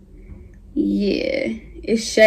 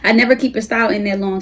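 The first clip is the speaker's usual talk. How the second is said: usual speaking voice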